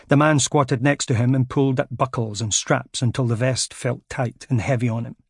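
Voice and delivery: ordinary delivery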